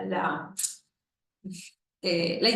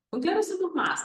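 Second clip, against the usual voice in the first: put-on much higher, childlike sing-song repetition; high child voice; imitating a small child; 11 semitones higher than usual. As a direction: parrot the phrase like a little boy echoing. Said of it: "había un pequeño que decía".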